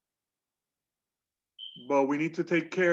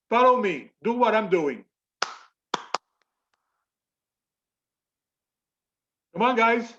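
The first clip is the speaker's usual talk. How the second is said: calling out to class; 5 semitones higher, 4 dB louder than usual. Real, not acted. Teacher rallying class to clap.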